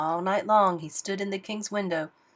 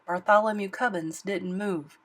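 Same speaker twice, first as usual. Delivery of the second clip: ordinary delivery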